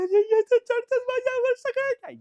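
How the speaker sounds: very high, loud mimicked wailing; over-the-top squealing complaint; mock whining screech. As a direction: screech it like a hammed-up tantrum. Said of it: "तिचं रड गाणं चालू झालं".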